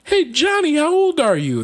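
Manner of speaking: high, put-on calling voice; hammed-up high-pitched question; mock questioner. Someone asking Johnny his age, high mock voice. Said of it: "They're like".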